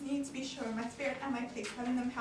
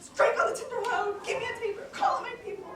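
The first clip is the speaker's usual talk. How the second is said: high, loud orders to the household; alarmed shouting; 10 semitones higher, 5 dB louder than usual. Acted. Brabantio rouses his household in alarm, shouting higher. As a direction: bark for lights and servants, much higher.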